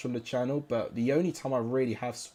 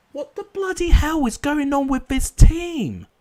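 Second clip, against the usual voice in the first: much higher and louder, raging; furious yell; 14 semitones higher, 12 dB louder than usual. Real, not acted. Gamer yelling at his losing side, furious. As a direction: explode at the team on screen.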